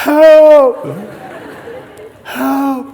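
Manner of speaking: very high, loud hammed-up scream; mock screaming for help. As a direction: scream out as your grip fails. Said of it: "And he calls again".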